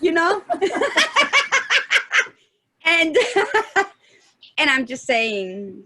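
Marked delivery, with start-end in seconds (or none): 0.5-2.3 high, burst-out laugh. Real, not acted. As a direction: laugh out loud at yourself. Laughing at own imitation.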